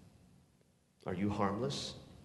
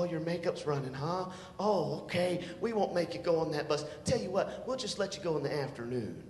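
doting dad; 6 dB quieter than usual. Retold or imitated. Lightly voiced dad soothing his crying daughter, soft. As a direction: coo like a soft-hearted dad.

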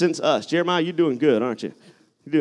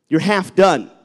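rising preacher's cry, loud; fiery preaching; 3 semitones higher, 4 dB louder than usual. Acted. Preacher warns congregation against half-baked faith, fiery. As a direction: drive the point home to the congregation.

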